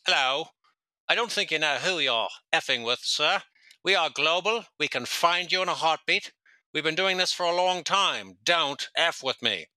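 hammed-up British phone threat; stupid Brit accent. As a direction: do a silly British crime boss making threats. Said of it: "going off in this stupid Brit accent".

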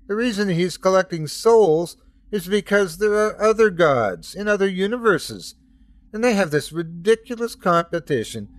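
agitated son warning against his father; Jesus speaking. Acted.

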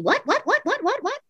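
high, repeated questioning yelps; incredulous. Acted. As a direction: fire it off high, each one a baffled question. Acted inner reaction to producer's note.

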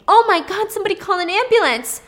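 high, loud, fast panicked scream; frantic shout for an ambulance. Retold or imitated. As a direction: scream for help. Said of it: "he starts screaming".

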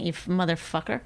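ordinary talk

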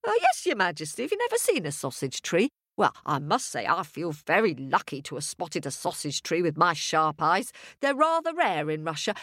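proud, prattling village wife; self-satisfied wife rattling on; chatty farmer's wife. Acted.

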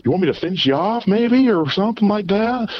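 high mock girl offering; imitating the girl's offer. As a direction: pitch her offer way up. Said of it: "It's like".

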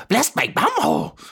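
squeaky high goblin gasp; startled goblin. Acted. Goblin peeking from the bushes, high squeaky exclamation. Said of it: "just hear him say".

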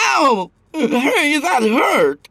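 high, whiny yelp of pain; bleating. A narrator's reading. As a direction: squeal like a hurt coward. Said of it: "he bleated".

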